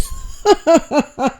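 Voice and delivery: high scoffing burst of laughter; mocking laugh. Real, not acted. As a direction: laugh her off, amused. Scoffing at the vegetable story.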